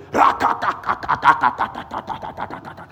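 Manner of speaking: loud rapid-fire "ka-ka-ka" volley; machine-gun burst of prayer syllables; explosive rattling prayer burst. Shown as a demonstration. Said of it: "I like some moments of".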